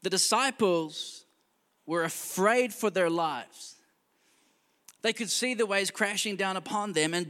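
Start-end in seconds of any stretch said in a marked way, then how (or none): 0.0-3.8 slow, weighted delivery of "The disciples were afraid for their lives"; a preacher slowing right down for the key line; slow emphasis. Shown as a demonstration. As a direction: lean on each word slowly so it sinks in. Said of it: "This is what I want you to catch tonight".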